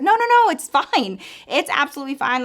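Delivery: high, loud no no no; flustered refusal. Retold or imitated. Clerk insists on making a sandwich, flustered high refusal. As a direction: brush off his offer in a hurry.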